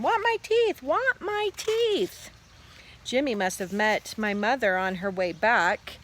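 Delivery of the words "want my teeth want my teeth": very high, drawn-out wail; toddler scream. A narrator's reading. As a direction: wail like a small child demanding. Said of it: "Fudge started to scream".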